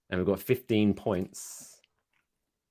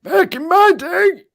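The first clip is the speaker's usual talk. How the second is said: high, slow, creaky grandpa; cranky old man voice; 12 dB louder, slower than usual. Pretend. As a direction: play a doddering old geezer. Mocking old people scolding kids.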